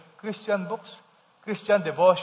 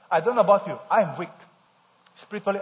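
usual speaking voice

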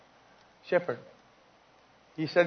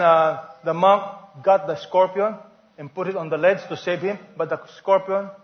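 normal talking voice

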